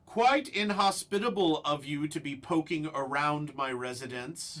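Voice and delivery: loud voice from everywhere at once; disembodied voice. Acted. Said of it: "you hear a voice that seems to come from all around".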